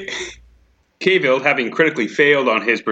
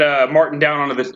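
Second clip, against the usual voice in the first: ordinary delivery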